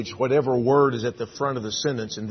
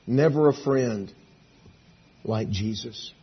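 hymn lyric recitation; 4 dB louder, slower than usual. Real, not acted. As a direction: recite it solemnly, pausing between phrases. Recites a songwriter's words.

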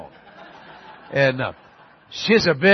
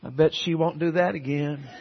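softer, hushed aside; whispered; 6 dB quieter than usual. Retold or imitated. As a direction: lean in and say it softly, just for the boy. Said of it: "He, he whispered".

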